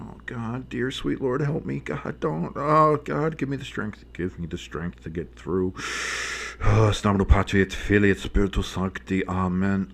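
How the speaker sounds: hushed, pleading mock prayer. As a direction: pray dramatically for strength. Mock prayer about Giants game.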